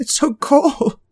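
slow high shivery whine; shivering wand voice. A narrator's reading. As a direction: whimper about the cold. Wandy shivering in snow.

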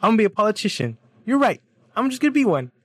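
high, loud, smug mock voice of a know-it-all student; mocking a classmate. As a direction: ham up the clueless classmate's grand announcement, pitched high. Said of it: "The guy's like".

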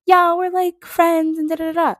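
high-pitched, dismissive mimicry; imitating interview answer. Fan mimicking the singer in interviews, imitating her. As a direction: pitch it way up and brush it off. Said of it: "in interviews, she's like".

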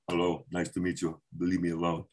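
put-on low Austrian accent, brushing a fan off; a curt Arnold greeting; Arnold Schwarzenegger. Friend meets Arnold in a Greek restaurant, low Austrian accent. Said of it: "he's like".

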